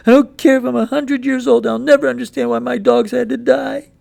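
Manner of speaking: put-on high, loud, breaking boy's voice; boy sobbing over his dead dogs; grieving boy. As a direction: cry it out to mama in protest. Boy protests.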